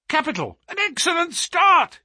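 high, delighted exclamation; sudden cry. Acted. Holmes watches the start through field glass, excited cry. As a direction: burst out, pitch leaping up. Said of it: "he cried suddenly".

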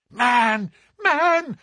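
high, loud desperate cry. A narrator's reading. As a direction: call out to stop him cold. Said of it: "she cried".

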